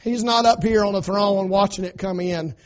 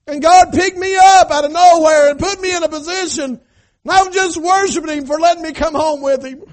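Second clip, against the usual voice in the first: joyful David; 7 semitones higher, 8 dB louder than usual. Retold or imitated. Preacher voicing David's joy.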